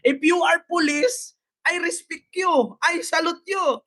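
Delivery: high, declamatory imitation of a woman; mock protester. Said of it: "Pareho kami ni ate mag-English". (panggagaya kay ate, mataas ang boses)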